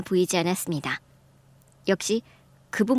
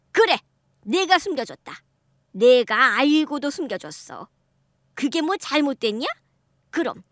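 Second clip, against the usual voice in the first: lightly voiced defiant mother-in-law; a hint of an unrepentant elder snapping back; 6 semitones higher than usual. Mother-in-law admits hiding affair.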